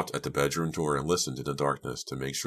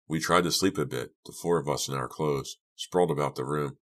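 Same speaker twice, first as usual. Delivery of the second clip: ordinary delivery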